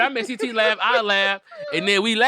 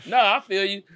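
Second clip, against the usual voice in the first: normal talking voice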